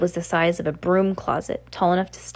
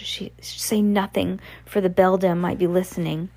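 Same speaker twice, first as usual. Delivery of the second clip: ordinary delivery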